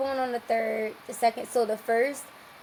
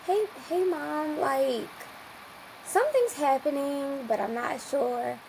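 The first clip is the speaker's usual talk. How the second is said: tentative; 4 semitones higher, slower than usual. Retold or imitated. Tentative call waking mom at night.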